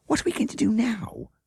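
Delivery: anxious question. Acted. Child asks anxiously.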